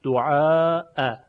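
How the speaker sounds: دعاء drawn out long, ending on a crisp "a" hamza; slow دعاء, long aa then a separate glottal "a"; hamza with fatha. Shown as a demonstration. Teacher showing the first hamza, open "a" after a long vowel. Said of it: "همزهٔ اول مفتوح".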